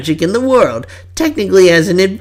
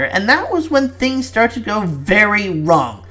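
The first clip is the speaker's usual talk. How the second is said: ordinary delivery, nothing marked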